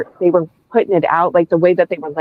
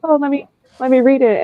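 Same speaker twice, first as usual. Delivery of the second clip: lifted a clear step higher, eager and quick; retold eagerness; 8 semitones higher than usual. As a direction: act out your past self keen to start the book. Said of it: "I was like".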